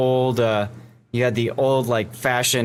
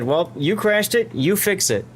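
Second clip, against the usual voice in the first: retold dad's verdict; 7 semitones higher than usual. Dad laying down the law.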